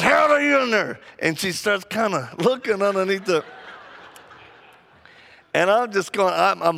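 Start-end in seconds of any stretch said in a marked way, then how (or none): 0.0-1.0 mock yell. Lady in the men's room calling her husband, high yell. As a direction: call the name out like a frantic wife. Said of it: "She goes".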